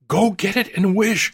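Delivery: high, loud, urgent command; excited cry. A narrator's reading. Wife sending her husband for the paw, excited cry. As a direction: order him off, wild with excitement. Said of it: "quivering with excitement".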